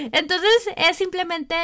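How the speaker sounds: light laugh then talking through it. Real, not acted. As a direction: laugh it off and carry on. Laughs after admitting preference.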